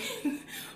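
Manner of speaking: a short, high giggle; high laugh. Real, not acted. Vlogger telling the Texas story, giggling. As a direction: laugh at the memory.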